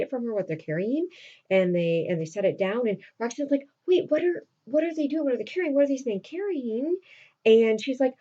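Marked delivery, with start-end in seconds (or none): 3.9-7.0 high, urgent run of questions; alarmed questioning. Retold or imitated. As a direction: imitate someone growing suspicious and worried. Roxanne suspiciously questions, acted.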